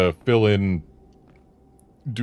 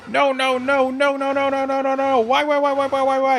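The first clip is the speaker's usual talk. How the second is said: panicked villain. Acted. Villain panics at name guessed.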